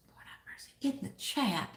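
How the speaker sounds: unvoiced whisper under breath; whispered aside. Real, not acted. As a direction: whisper it to yourself. Aside muttered to herself.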